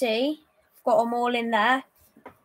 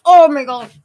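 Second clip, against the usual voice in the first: sudden loud gasp at the sourness; eating sour candy; 3 semitones higher, 14 dB louder than usual. Real, not acted.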